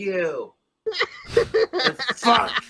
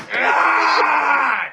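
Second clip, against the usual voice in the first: higher, louder yell cracking into laughs; laughing rage; 11 semitones higher, 4 dB louder, much slower than usual. Real, not acted. Man cursing the bottle while laughing, laughing rage. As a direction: rage and laugh at yourself at once.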